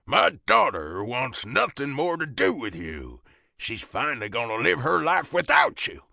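put-on forceful, thick southern accent; angry southern father. A narrator's reading. Southern father standing over the fallen biker, angry. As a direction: lay down the law in a southern accent.

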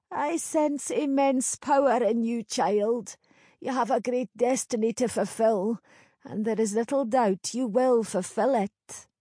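put-on knowing old village crone. Crone prophecy.

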